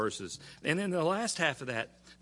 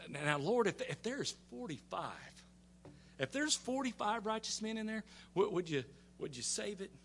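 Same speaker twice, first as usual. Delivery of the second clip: higher, softer, careful plea; Abraham bargaining; 7 semitones higher, 5 dB quieter than usual. Retold or imitated. Abraham slyly bargaining the Lord down, soft and tentative. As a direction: ease the request in high and soft. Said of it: "he's pretty smart. He goes".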